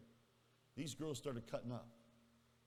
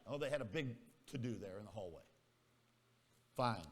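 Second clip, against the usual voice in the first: normal talking voice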